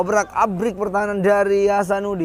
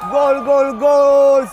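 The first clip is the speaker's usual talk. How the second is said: high, loud goal call; long goal yell; 8 semitones higher, 9 dB louder, much slower than usual. Real, not acted. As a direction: belt out the goal. Commentator celebrating a goal.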